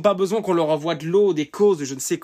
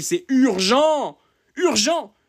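slow, high, loud urgent warning; urgent emphasis; 8 semitones higher, 4 dB louder, much slower than usual. Real, not acted. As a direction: stretch out the urgency. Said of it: "je suis dur". (insistance lente et forte sur urgent)

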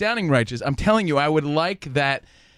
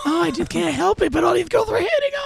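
high-pitched mocking voice; mock whining boast; 13 semitones higher than usual. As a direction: put on a high, helpless braggart. Mocking Rich's boast in his role.

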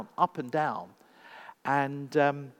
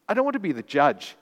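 fast, louder refusal; loud protest; 4 semitones higher, 8 dB louder, faster than usual. A narrator's reading. Mackenzie refusing to judge his children, loud protest. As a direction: push back hard, rushed. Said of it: "he said, standing up".

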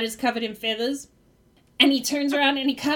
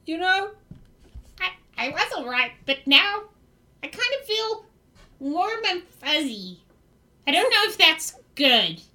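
dazed goblin puzzling out a new feeling; slapped goblin; 2 semitones higher than usual. Acted. Slapped goblin tells the party how it feels, dazed goblin voice. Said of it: "The one who got slapped is like".

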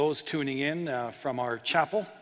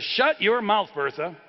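louder, higher acted outburst; losing his temper; 4 semitones higher, 4 dB louder than usual. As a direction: snap at the wife, hard on the first words then dropping. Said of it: "now he's losing it".

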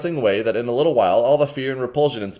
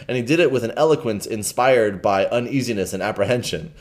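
usual speaking voice

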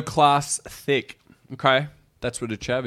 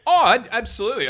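laughing; 8 semitones higher, 8 dB louder than usual. Real, not acted. Laughing eager agreement.

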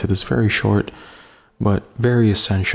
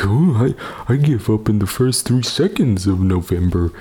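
Homer Simpson voice. Retold or imitated. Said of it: "did I just sound like fucking Homer Simpson".

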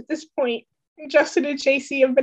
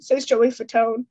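ordinary delivery, nothing marked

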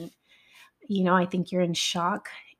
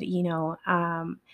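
usual speaking voice

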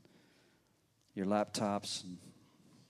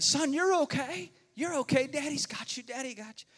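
soothing dad; 13 semitones higher than usual. Retold or imitated. Father comforting son after the snake scare. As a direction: hush a scared child, reassuring. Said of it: "Brett was saying".

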